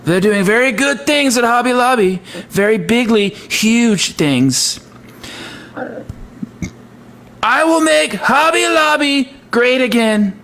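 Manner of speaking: put-on president voice, pitched well above normal; Donald Trump. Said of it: "that's my best Trump impression".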